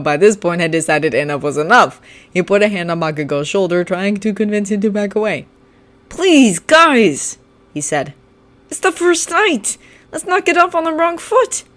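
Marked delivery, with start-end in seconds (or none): 6.1-11.6 higher, gentle pleading voice; calm the boys down; peacemaking plea. Acted. Kirishima pleading for peace, higher voice.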